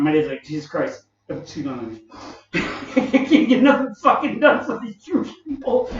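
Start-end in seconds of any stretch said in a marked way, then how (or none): 2.5-6.0 laughing through it. Retold or imitated. Dad's complaint quoted, laughing.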